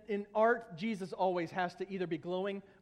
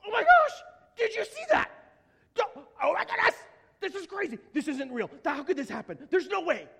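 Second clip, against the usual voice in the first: high, loud, frantic disbelief; over-the-top squealing shock; acted astonishment; 9 semitones higher, 8 dB louder than usual. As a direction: freak out, shrieking in hammed-up amazement. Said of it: "they were out of their minds".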